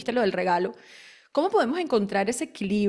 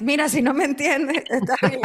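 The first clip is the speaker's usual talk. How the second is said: carefree, unapologetic; 3 semitones higher than usual. Retold or imitated. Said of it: "tengo un acento como Sofía Vergara".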